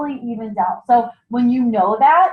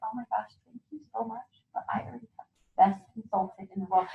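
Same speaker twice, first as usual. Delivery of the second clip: breathy, unvoiced gush of thanks; polite whisper; 10 dB quieter, faster than usual. Shown as a demonstration. Consultant teaching a guest to decline, in a whisper. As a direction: whisper it sweetly, like a secret.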